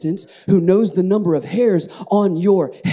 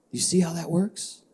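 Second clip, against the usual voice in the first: ordinary talk, nothing marked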